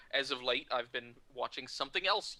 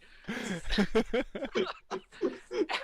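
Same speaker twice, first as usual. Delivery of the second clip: loud, high laughing fit; long real laugh; 10 semitones higher, 6 dB louder than usual. Real, not acted. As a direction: crack up at your own punchline. Cracks up at own story.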